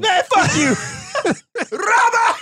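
laughing mock outrage at his cohost; laughing shout. Real, not acted. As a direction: yell it through laughter.

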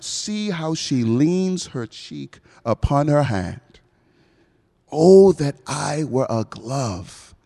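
slow, yearning stage verse; dramatic Shakespeare recital. Acted. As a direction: lovestruck Romeo, lingering on every word. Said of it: "then he goes on to say".

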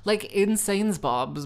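ordinary delivery

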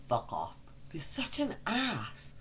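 ordinary talk

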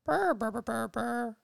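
high rolled burr calls; bird trill. Acted. Bird character chirping.

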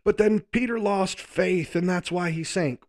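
high, loud imitation of a fired-up preacher; bold preaching. Imitating how others preach Peter's boldness, bold and loud. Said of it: "heard the boldness of Peter preached".

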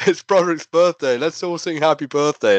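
high-pitched imitated announcement; announcing to a crowd. Wrestler rallying fans for a birthday song, imitated call. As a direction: call it out high to the whole queue. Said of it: "she just gets up and is like".

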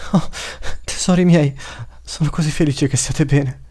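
high, emotional mother's voice; tearful relief. A narrator's reading. Mother reunited with her children, tearfully relieved. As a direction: gush with relief, close to happy tears. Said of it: "dice con le lacrime agli occhi".

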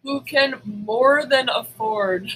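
normal talking voice

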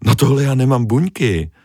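building from stern to high indignant; offended scolding. Acted. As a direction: scold him and turn away in a huff. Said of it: "Malá ryba se zamračila".

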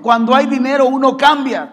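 loud, high, emphatic repeat; wholehearted preaching. Shown as a demonstration. Preacher repeating his point on money, wholehearted preaching. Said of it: "Voy a volver a decirlo con todo mi corazón".

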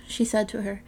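ordinary delivery, nothing marked

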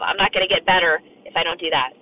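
ordinary talk, nothing marked

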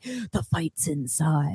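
slow, low and hushed; a hint of movie gravitas; low dramatic whisper. Quoting actor's line.

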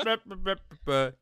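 put-on loud, high drunk babble. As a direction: imitate a drunk toddling along. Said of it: "I should change my voice".

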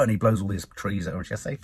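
normal talking voice

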